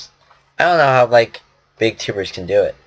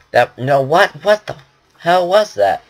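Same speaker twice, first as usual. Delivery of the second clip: taken aback; 5 semitones higher than usual. Real, not acted. Startled at own failed accent.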